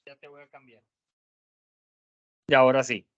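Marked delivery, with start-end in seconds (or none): 0.0-0.8 distant, faint voice far from the microphone; far from the mic. Real, not acted. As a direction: speak from across the room, away from the mic. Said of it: "lo escuchamos ahorita un poquito lejos".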